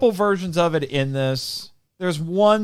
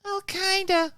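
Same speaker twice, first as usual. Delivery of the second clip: put-on tiny, high, soft kid voice; auditioning little girl; 6 dB quieter, slower than usual. Child actor answering casting people, small high voice. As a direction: squeak out an unsure child's answer. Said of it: "And they're like".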